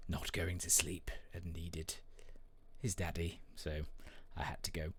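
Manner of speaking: unvoiced, barely above breath; whisper. Real, not acted. Father whispering about his son, hushed whisper.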